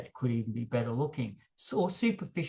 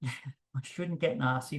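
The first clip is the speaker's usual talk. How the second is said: ordinary delivery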